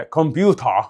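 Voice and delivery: slow British "كمبيوتر" with strong T; exaggerated British. Shown as a demonstration. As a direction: overdo "كمبيوتر" as "kom-byu-tah", no R. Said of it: "انا ببالغ عشان اوصل لك".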